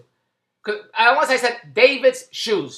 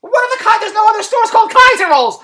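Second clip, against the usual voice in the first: high, rising mock shop girl, louder at the end; mock clerk voice; 10 semitones higher, 4 dB louder than usual. Vlogger mocking the mall girl's reply, high and exasperated. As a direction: imitate a baffled shop girl, pitched up. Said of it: "The girl would say".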